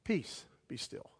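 low, soft, slow command; quiet stilling command; calm authority. Retold or imitated. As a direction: hush the sea with authority. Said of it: "has the authority to say".

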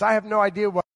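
usual speaking voice

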